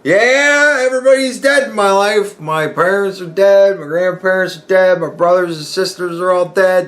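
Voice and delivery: put-on high, loud old lady reciting the dead; grandma's grim list. Grandson voicing grandma at her birthday party, high bleak old lady. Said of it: "she goes".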